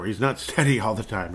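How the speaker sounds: usual speaking voice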